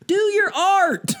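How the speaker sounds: slow, very high mock Southern drawl; [name], Southern accent. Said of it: "[name] talking in a Southern accent".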